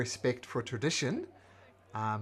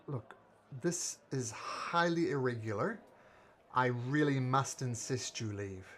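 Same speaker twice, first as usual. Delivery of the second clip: usual speaking voice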